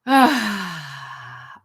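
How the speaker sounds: audible sigh. Shown as a demonstration. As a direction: let it all out in a sigh. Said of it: "you can exhale with a sigh sound".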